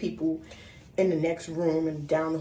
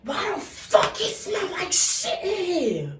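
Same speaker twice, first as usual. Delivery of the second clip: high, slow, furious yell of a mother; angry mother; 13 semitones higher, 4 dB louder, slower than usual. Retold or imitated. Mother yelling through the hotel room about the smell, high angry shout. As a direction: bellow it like a mom who smells something. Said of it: "I'm sure this is how she was".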